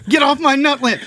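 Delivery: high, loud squirrel shout; angry squirrel. Acted. Squirrel yelling at intruder on his nut land. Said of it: "He was like this".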